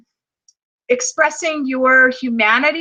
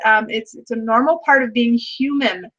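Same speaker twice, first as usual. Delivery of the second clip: ordinary talk, nothing marked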